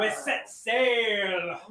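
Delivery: slow, high, loud pirate call. Acted. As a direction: drag it out like a captain. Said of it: "pirate voice".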